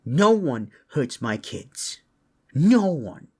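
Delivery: loud, high, fired-up threat; enraged parent, voice leaping up; furious vow. Acted. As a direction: swear revenge at the top of your voice. Furious parent vows revenge on kidnapper.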